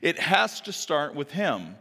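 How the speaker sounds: usual speaking voice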